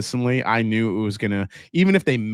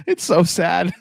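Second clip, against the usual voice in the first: laughing it out, a bit higher; 5 semitones higher, slower than usual. Real, not acted. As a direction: laugh through the words "It's so sad". Laughing at his team's old title.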